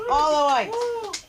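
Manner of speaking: stretched playful repeat of all the whites; mock echo. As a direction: repeat the comic's phrase slowly to milk the laugh. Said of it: "How did I guess that?".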